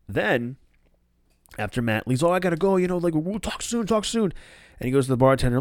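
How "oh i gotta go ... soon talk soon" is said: hurried goodbye. Retold or imitated. Matt hurrying away.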